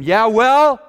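loud, high, determined retort; defiant comeback. Acted. Woman holding on after being called a dog, defiant comeback. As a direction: throw it back, refusing to let go. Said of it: "there was this steely determination".

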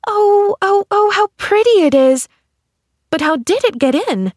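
put-on high, loud girlish rapture; gasping wonder turning to eager question; delighted cry. A narrator's reading. Pollyanna clapping at the rainbow, crying out in delight. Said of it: "she cried".

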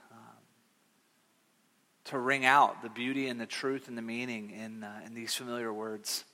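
low, soft, hushed praying voice; subdued, reverent pastoral prayer. Real, not acted. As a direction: pray quietly, pausing to find the words. Opening prayer.

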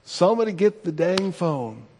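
mildly exasperated recap of a yell; mock chastising. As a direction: replay the scolding without shouting. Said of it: "how I was chastising all of them".

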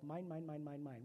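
lightly voiced three-year-old clutching his toys; childish mine. Preacher mimics a greedy toddler, mildly voiced. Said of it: "Three-year-old".

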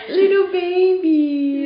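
put-on high, slow cooing baby talk; high drawn-out baby voice to a dog. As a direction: coo it like talking to a puppy. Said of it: "Or, like".

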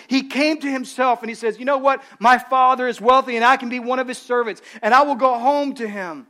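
loud, high sermon delivery; fervent preacher voice; preaching. Acted. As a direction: preach it out to the congregation. Preaching at full force.

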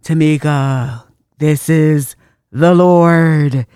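loud, slow booming divine voice; hammed-up heavenly announcement; mock voice of the Lord. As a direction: boom it out like God calling down. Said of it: "Is it like".

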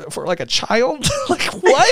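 high, incredulous squeal with laughter; appalled disbelief. Real, not acted. Objecting to the P word, high and appalled. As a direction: voice climbing in disbelief.

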